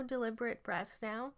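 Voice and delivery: ordinary delivery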